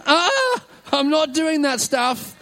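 high squeak that drops as it goes on; squeaky, high-pitched mock refusal. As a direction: squeal it out, then draw out the refusal. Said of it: "even in a high-pitched, squeaky voice".

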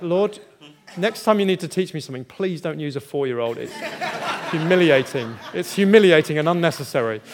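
loud, laughing protest to the Lord; laughing mock complaint. Preacher laughing as he complains to God about being taught. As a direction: laugh through a mock-outraged prayer. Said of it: "I was like".

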